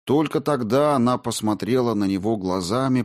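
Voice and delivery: ordinary delivery, nothing marked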